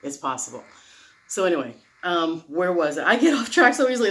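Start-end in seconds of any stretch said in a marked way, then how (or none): 3.0-4.1 quick, laughing at herself. Real, not acted. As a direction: laugh through it, a bit faster. Laughs at own rambling.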